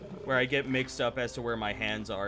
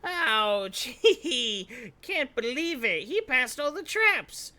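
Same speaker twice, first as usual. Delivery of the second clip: high giggling goblin; 10 semitones higher, 4 dB louder, slower than usual. Pretend. Player watching traps get passed, high giggly goblin voice. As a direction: squeal it with a laugh, pitched high.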